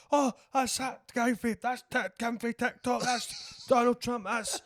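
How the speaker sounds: high, slow, starstruck calling out of names; excited spotting. Retold or imitated. A raver at the SEC spots celebrities, high and slow with excitement. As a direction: gasp at each famous face in turn. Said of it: "and I was like".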